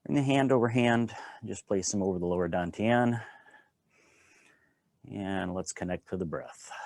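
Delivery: unhurried, calm guiding voice with long pauses; guided qigong instruction. Acted. Teacher guiding a standing qigong class, calm and unhurried. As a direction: talk the class gently through each movement.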